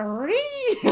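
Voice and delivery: high squeaky mock screech; monster screech imitation. Retelling how the monster shrieked, high mock screech. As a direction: squeal like the angry creature. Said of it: "She's like".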